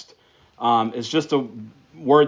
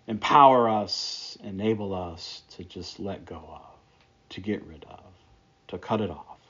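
quiet, hushed prayer voice; 4 semitones lower, 3 dB quieter than usual. Acted. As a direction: pray it gently, softening as it goes. Closing prayer before the sermon.

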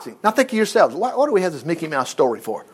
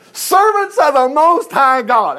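loud, high, slow proclamation; calling out; 8 semitones higher, 6 dB louder, slower than usual. Acted. King calling to the servants in the fire, loud and slow. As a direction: call it out grandly and slowly.

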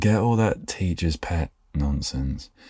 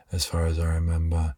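ordinary talk

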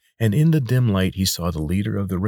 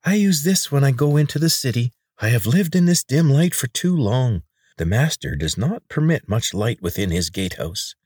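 high, oily voice of a chubby healer; pudgy man; 8 semitones higher, 4 dB louder than usual. A narrator's reading. Chubby healer coaxing the injured boy, high and oily.

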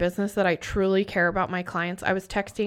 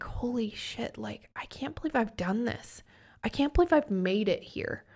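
a hushed, breathless whisper of disbelief; awed whisper; 6 dB quieter than usual. Retold or imitated. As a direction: whisper it like you can't believe it. Amazed at her own success.

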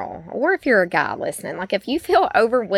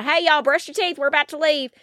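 mild mock yell, pitched up; retold yelling; 6 semitones higher than usual. Mom hurrying kids before leaving, mild mock yell. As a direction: call out to the kids without real volume. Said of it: "I'm just kinda randomly yelling".

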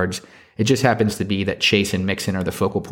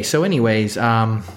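ordinary delivery

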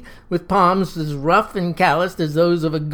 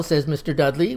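ordinary delivery

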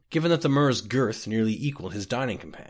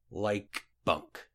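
dry; 2 semitones lower, 6 dB quieter than usual. A narrator's reading. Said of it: "searching for the appropriate word".

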